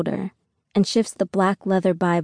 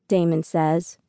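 plain reading voice

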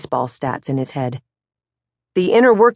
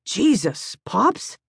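softer, exasperated Jesus, Pops; disgusted; 5 semitones higher, 4 dB quieter, much slower than usual. Acted. Billy to his father after the fight, disgusted. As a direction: shake your head at him. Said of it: "in disgust".

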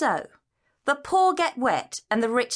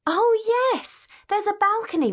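sudden high delighted oh; surprised; 10 semitones higher than usual. Acted. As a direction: gasp with pleased discovery. Looking up, spotting balcony musicians.

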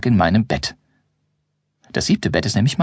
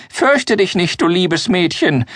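put-on much higher, slow, whiny old voice; a doddering old man soothing a girl; 8 semitones higher, slower than usual. Grimbold comforts the girl in his whiny old-man voice. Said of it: "mit seiner weinerlichen Altmännerstimme".

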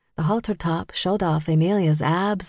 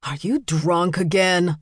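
mildly exasperated husband's rebuke; understated bark. A narrator's reading. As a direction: scold the tipsy wife. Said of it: "he barked to his wife".

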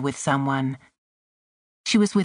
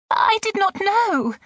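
very high, distraught protest; panicked cry. A narrator's reading. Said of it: "the Mem Sahib cried".

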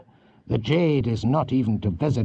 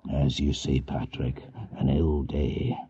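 grim echo; 7 semitones lower, 4 dB quieter than usual. A narrator's reading. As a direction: echo it heavily, like an old man conceding. Huntly echoes, grim and low.

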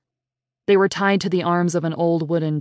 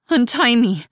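higher, louder clenched demand; through her teeth; 7 semitones higher, 5 dB louder than usual. Acted. Terrified girl ordering her captor, through her teeth. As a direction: force it out through a locked jaw. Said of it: "Zoe said through her teeth".

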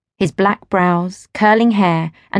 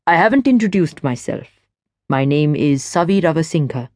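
lower, easy man's voice; smooth stranger; 3 semitones lower than usual. A narrator's reading. Stranger chats to Gwen at the rail, low and easy. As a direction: speak low and relaxed, at ease.

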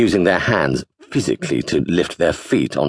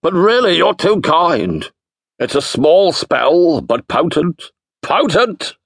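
booming wizard, slow and grand; 12 semitones higher, slower than usual. A narrator's reading. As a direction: savour the word potent. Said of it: "he said".

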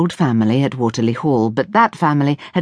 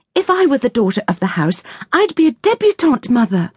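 protesting; 8 semitones higher than usual. Acted. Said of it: "Ruth protested".

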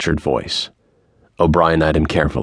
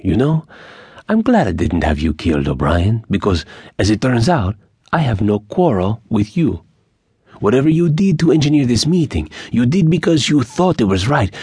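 put-on higher, lilting accented bandit voice; magnanimous Mexican bandit; 5 semitones higher than usual. A narrator's reading. Bandit tells O'Brien he is blameless, magnanimous. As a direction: absolve him grandly, pitch riding high.

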